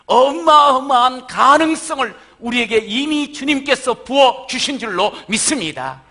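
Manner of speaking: loud, high, fervent preaching; full-force preaching. Real, not acted. Pastor stirring the congregation, loud fervent preaching. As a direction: preach it out with rising power.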